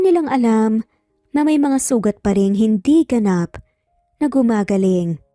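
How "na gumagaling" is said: ordinary delivery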